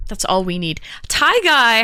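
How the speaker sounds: ordinary talk, nothing marked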